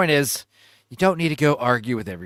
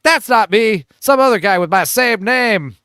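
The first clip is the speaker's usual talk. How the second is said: higher and louder, a hammed-up indignant denial; mock defensive protest; 6 semitones higher, 6 dB louder than usual. Streamer voicing an online stranger's denial, mock indignant. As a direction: play the offended guy, pitched up. Retelling the other guy's protest.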